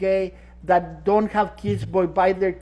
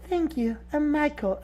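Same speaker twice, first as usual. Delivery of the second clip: light high quiet imitation; 6 semitones higher, 5 dB quieter than usual. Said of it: "his voice isn't that high".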